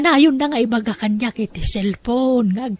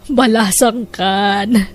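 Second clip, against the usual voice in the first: usual speaking voice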